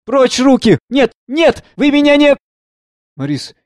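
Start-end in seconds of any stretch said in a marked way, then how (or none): 0.0-2.4 high, loud cries of protest; girl shouting. A narrator's reading. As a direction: cry out to fend them off, pitched very high. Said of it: "Девушка кричит". (кричит, отбиваясь)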